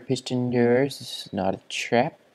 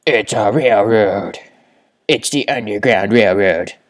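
loud thrilled exclamation; excited shout; 12 dB louder than usual. Real, not acted. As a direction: shout it out in delight. Excited discovery.

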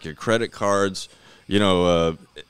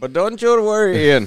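high, loud mock Indian reassurance; hammed-up Indian accent; 11 semitones higher, 8 dB louder than usual. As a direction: imitate an Indian agent soothing a customer. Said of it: "your Indian voice is awesome".

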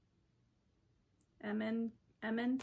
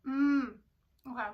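talking between bites; chewing while talking; 8 dB louder than usual. Real, not acted. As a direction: mouth partly full. Seen 1: Eats snack before; hand at mouth chewing at first words.

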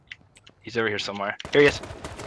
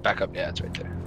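hushed callout to a teammate; whispering; 7 semitones lower, 8 dB quieter than usual. Real, not acted. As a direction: keep it under your breath. Quiet callout while sneaking up.

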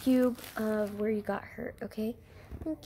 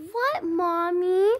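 put-on innocent kid voice; 6 semitones higher, 6 dB louder, much slower than usual. Child plays innocent.

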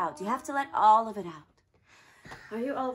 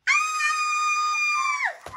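squeaky scream; 7 dB louder than usual. Acted. Mouse caught by cat.

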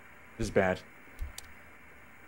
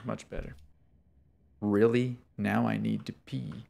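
normal talking voice

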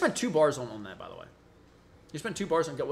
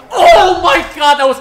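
hyped shout; 8 semitones higher, 14 dB louder than usual. Real, not acted. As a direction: shout in amazed commentator hype. Commentator reacting to exciting play.

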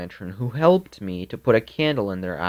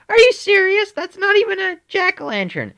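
very high, louder sugary mom voice; sweet mommy voice; 4 dB louder than usual. Retold or imitated. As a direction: play mom in a squeaky high sing-song. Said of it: "And she said".

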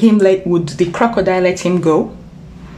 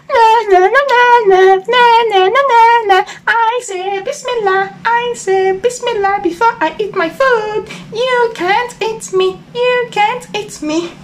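high, sing-song playground taunt; gleeful nyah-nyah needling; teasing frog. A narrator's reading. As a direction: chant it mockingly, pitched way up. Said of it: "He was teasing the crocodile".

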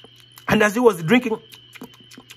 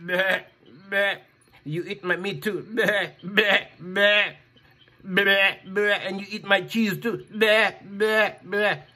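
bleating goat; 2 semitones lower than usual. Acted. Goat tells the boy off with bleats. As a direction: bleat between words.

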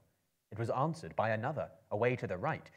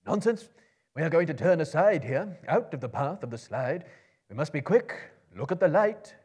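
loud, high, urgent command; urgent wizard; 7 semitones higher, 12 dB louder than usual. A narrator's reading. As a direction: drive the tired dwarves on. Gandalf urges the company.

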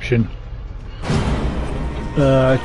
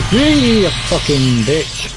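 slow, deliberate swearing; slow drawn-out curse; 4 semitones higher, 3 dB louder, much slower than usual. Real, not acted. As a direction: drag the insult out slowly. Slow drawn-out insult to monster.

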